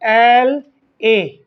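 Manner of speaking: "एल ए" drawn out letter by letter, very slowly; "एल ए" pronounced as separate drawn-out letters; spelling out letters. Shown as a demonstration. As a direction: sound out each letter of MLA slowly. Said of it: "जब इसका उच्चारण करेंगे तो एम".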